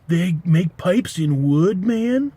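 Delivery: slow, amazed stoner drawl rising high on man. Retold or imitated. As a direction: gape in slow stoner wonder. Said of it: "that accent was".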